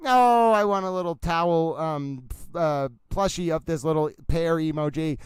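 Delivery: normal talking voice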